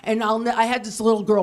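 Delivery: usual speaking voice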